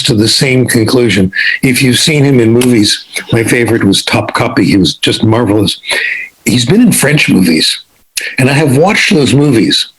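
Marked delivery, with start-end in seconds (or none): none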